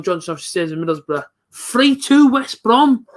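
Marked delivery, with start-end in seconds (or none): 1.5-3.1 slow, drawn-out, higher and louder disbelief; stunned groan. Real, not acted. Fan to co-host after conceding, stretched disbelieving moan. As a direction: let the score sink in, dragging every word. Reacting to opponent's second goal.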